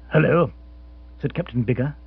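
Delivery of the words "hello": ordinary delivery, nothing marked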